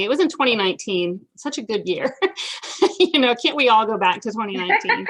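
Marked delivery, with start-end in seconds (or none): 2.2-2.9 breathy laugh. Real, not acted. Laughs about 2019.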